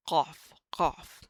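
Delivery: "Cough" with a deep, throaty Q, loud. Shown as a demonstration. Teacher demonstrating the Arabic Q, loud. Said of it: "more like a Q".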